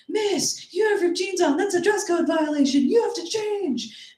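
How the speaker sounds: student voice. Retold or imitated. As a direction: mimic a tattletale schoolgirl. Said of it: "she was like".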